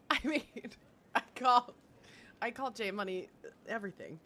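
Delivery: normal talking voice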